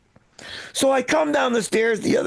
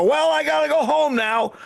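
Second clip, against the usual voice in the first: put-on higher-pitched, singsong old lady; confused mother-in-law; 6 semitones higher than usual. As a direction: imitate a mother-in-law announcing her departure. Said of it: "my mother-in-law get up from her chair and go".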